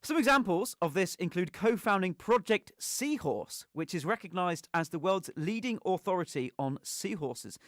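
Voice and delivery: measured, evenly stressed presenter read; scripted radio introduction. Real, not acted. Host introduces scientist for Christmas special, measured scripted read. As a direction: read the guest's biography out with care. Reads prepared introduction of next guest.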